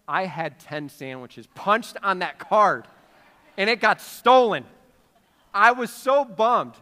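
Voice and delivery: loud, punched-out comic indignation; slow, emphatic mock grievance; mock outrage. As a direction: play up the petty loss as a great injustice, loudly. Comic outrage over stolen punch card.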